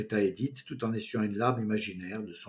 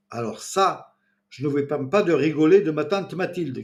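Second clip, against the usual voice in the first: shouting; 5 semitones higher, 7 dB louder than usual. A narrator's reading. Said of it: "cria Pascal".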